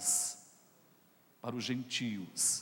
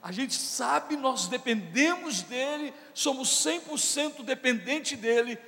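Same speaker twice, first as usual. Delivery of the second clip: loud, higher preaching build; preaching at full force; 9 semitones higher, 6 dB louder than usual. Acted. Pastor fires up the congregation, loud rising preaching. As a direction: drive the sermon upward with urgency.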